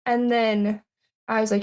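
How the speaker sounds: normal talking voice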